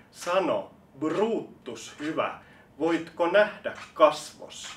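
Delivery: high, loud, slow stage recital; theatrical declamation. Acted. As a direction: proclaim it like an old tragedian. Declaimed Shakespeare quote. (korkea, kova teatraalinen lausunta)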